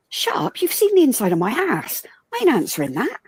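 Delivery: cheeky retort. Retold or imitated.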